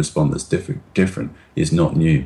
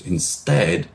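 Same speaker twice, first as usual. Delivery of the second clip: normal talking voice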